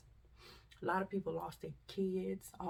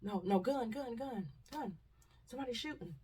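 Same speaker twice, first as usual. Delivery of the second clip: frightened bystander; 4 semitones higher than usual. Retold or imitated. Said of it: "and they said".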